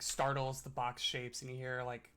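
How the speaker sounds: normal talking voice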